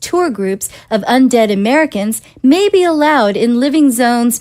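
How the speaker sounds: measured broadcast news voice; steady newsreader delivery; news anchor read. Acted.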